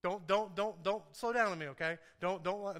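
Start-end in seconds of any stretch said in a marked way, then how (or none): none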